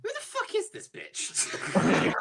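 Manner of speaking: lightly hammed-up affront; mock indignant. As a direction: drag it out in outraged disbelief. Engines react to snooty newcomer.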